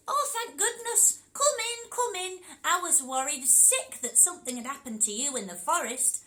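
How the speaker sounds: grandma relieved at the knock. Acted. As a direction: cry out in relief as granny. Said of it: "said Grandma".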